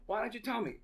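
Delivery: high-pitched indignant question; indignant gang boss. Retold or imitated. Gang boss questions the bank worker, indignant high-pitched retelling. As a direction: act out his challenge, pitched well up. Gang leader repeats his challenge.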